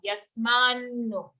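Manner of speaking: yasmanno with a long, held double n. Shown as a demonstration. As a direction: lean slowly on the nn in yasmanno. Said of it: "it's a double n sound".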